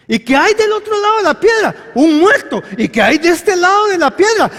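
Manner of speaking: high, loud rhetorical questioning; forceful preaching. Real, not acted. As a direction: fire the questions at the congregation.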